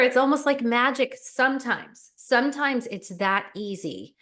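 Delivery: ordinary talk, nothing marked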